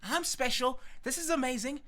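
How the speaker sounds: thrilled and high-pitched, bouncing with self-importance; giddy, high, excited boasting; happy, gloating. Acted. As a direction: crow with delight at being singled out. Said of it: "he's happy".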